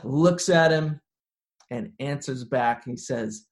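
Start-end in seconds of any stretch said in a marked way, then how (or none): none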